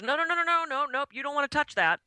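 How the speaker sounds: high, urgent no-no-no, imitating the foot bath guy; alarmed practitioner. Guy stopping her from touching the foot bath scum, high and urgent. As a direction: play the practitioner rushing to stop her. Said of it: "and the guy goes".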